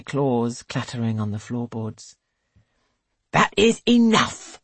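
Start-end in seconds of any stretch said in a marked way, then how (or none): 3.3-4.6 loud, slow stern command; stern rebuke. A narrator's reading. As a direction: cut the girls off firmly. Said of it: "says Missus Chapel".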